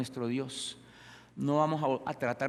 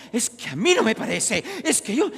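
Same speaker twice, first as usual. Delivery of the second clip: high, loud imitated protest; exaggerated squabbling excuse; mock arguing; 9 semitones higher, 10 dB louder than usual. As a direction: argue back like a stubborn objector, hammed up. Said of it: "porque nosotros peleamos".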